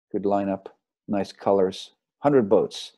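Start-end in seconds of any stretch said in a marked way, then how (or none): none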